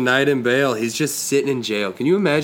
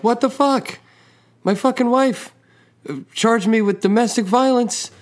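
outraged husband; 11 semitones higher than usual. Retold or imitated. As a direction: play the husband, shocked and outraged. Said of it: "And the husband's like".

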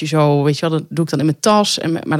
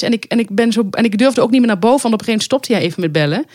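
ordinary talk, nothing marked

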